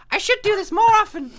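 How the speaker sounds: perky heroine voice. Acted. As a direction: gush like a carefree cartoon heroine.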